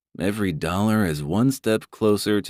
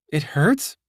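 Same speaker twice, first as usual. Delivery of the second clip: higher, worried question; mild surprise; 9 semitones higher than usual. Acted. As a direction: ask it a little alarmed. Brandy surprised.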